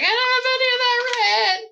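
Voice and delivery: high-pitched panicked squeal; mock freak-out.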